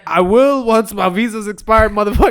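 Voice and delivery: defiant retort. Retold or imitated. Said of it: "and I was like".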